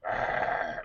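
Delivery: low creature growl, like a movie monster. Retold or imitated. Father imitating his T-shirt monster face, growling. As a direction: growl "arr" like the Creep. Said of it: "we both had these expressions on our face".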